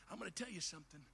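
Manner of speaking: usual speaking voice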